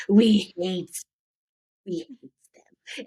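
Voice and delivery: loud creature voice sinking into a high, slow whisper; Schmeagol. Acted. Hamming up the first name for her inner voice, whispering creature impression. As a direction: repeat the creature's catchphrase, breaking into whispery hissing. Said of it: "at first, he named it Schmeagol".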